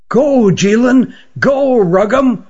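high, slow, drawn-out calls of farewell; cheering them on. A narrator's reading. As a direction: send them off with a rising cheer. Farewell called to departing birds.

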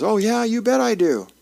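mildly acted, high-pitched eager reply. A narrator's reading. Little boy from down the street answers the neighbor, eager.

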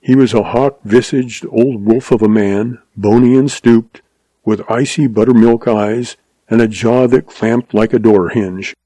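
ordinary delivery, nothing marked

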